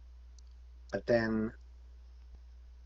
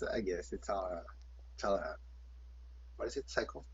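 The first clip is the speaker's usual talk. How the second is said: trailing off; 2 semitones lower, 8 dB quieter than usual. Real, not acted. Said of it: "oh shoot, I lost my train of thought".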